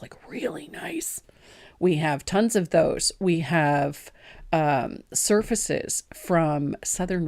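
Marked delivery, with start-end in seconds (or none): 0.0-1.2 breathy whispered aside; whispering. Real, not acted. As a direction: drop to a whisper. Aside after praising the stamps.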